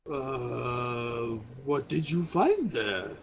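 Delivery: slow, lightly voiced concerned companion; a touch concerned. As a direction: ask slowly, with mild concern. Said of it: "Gazrim for his part looks a bit concerned".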